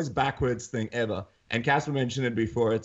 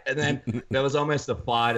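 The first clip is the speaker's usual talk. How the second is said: normal talking voice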